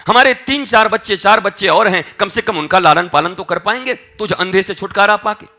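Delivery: loud, high-pitched tirade; angry rejection. Retold or imitated. Parents telling their blind six-year-old to leave, angry rejection. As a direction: act out parents throwing a child out.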